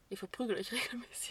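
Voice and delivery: breathy whispered disbelief with a laugh; stunned, half-laughing whisper. Pretend. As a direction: whisper it through a giggle. Imagined confession of regular beatings.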